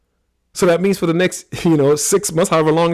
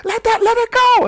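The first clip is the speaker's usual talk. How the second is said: high, loud goading voice, much higher and louder; mock taunt; 8 dB louder than usual. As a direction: goad the linemen loudly and high. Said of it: "My wife is sitting there like".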